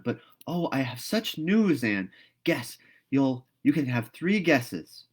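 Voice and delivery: put-on a girl who can't wait to tell; breathless eager girl with big news; excited Diana.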